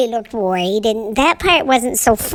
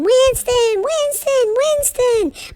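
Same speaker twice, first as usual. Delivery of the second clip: high, far-carrying call of a name; daddy hollering; 12 semitones higher, much slower than usual. Retold or imitated. Dad searching the yard, calling for the hidden dog. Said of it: "he's hollering".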